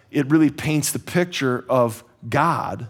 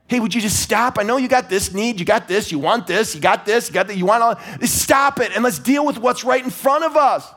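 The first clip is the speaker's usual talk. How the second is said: voicing God; 6 semitones higher than usual. God pleads with his people, rising to a shout. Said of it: "He's like".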